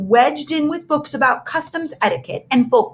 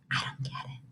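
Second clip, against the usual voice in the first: breathy unvoiced whisper; 18 dB quieter than usual. A narrator's reading. Lenny whispering to Bruce during the song, in a hushed whisper. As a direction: whisper it so the teacher can't hear. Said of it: "whispered Lenny".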